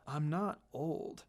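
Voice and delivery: slow, soft murmur; quiet grumbling denial. Acted. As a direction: mumble it low and slow. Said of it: "Kakashi murmured".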